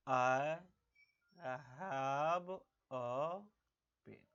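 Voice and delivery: "I have a pen" with a pause after each word; word-by-word example. Shown as a demonstration. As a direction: space out every word for learners. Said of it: "जैसे देखो".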